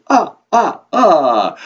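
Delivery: loud, high staccato vampire laugh; booming triumphant "ah, ah, ah" laugh; Count's laugh. Acted. Count delighted with his counting, loud staccato vampire laugh. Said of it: "You don't have to laugh after everything you say".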